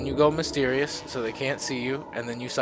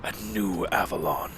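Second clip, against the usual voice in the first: low, slow, whispery Lich King; 3 semitones lower than usual. Acted. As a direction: ancient lich, hushed and slow. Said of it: "I'm trying to get the Lich King voice back".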